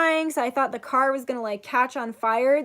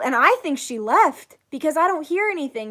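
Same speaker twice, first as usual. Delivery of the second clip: usual speaking voice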